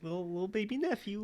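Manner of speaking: put-on baby talk. Teasing Gabe about being the little nephew, cooing baby talk. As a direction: coo it like talking to a toddler.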